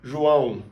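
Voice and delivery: João said zhoo-ow with a nasal ending. Shown as a demonstration. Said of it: "or in the first name".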